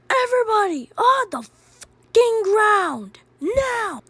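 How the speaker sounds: high-pitched robber's yell; yelled orders. Acted. Robber bursting into a restaurant, yelling orders. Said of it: "Roderick kept yelling out orders".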